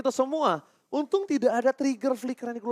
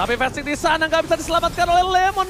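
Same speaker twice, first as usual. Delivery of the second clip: hyped caster; 6 semitones higher than usual. Real, not acted. Live play-by-play.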